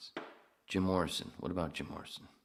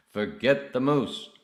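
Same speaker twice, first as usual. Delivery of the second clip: loud, higher, drawn-out character voice; acted movie reply; 8 semitones higher, 13 dB louder, slower than usual. Playing Arthur waving off the moose, slow loud acted voice. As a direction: dismiss it slowly and loudly in character.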